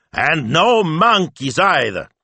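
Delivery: high, loud, drawn-out exclamation; slow, booming ban; mock-stern cry. A narrator's reading. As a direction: stretch it out like an exasperated papa. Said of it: "he cried".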